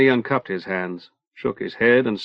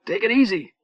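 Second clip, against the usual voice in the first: pleading; 8 semitones higher than usual. A narrator's reading. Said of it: "Eddie was pleading".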